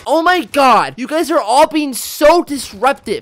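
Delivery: high, slow, prissy scolding of classmates; teacher's pet scolding. Retold or imitated. Kid teacher's pet scolding disruptive classmates, high and slow. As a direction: look down on the whole class. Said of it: "I'd be like".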